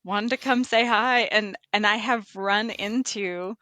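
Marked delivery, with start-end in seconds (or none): 0.0-1.2 higher, louder earnest protest; mildly acted sincere outburst; inner realization. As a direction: replay the moment it dawned on you, pitched up. Teller voices his own realization.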